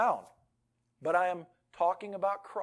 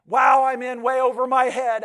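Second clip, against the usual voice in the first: overwhelmed; 4 semitones higher, 9 dB louder than usual. Acted.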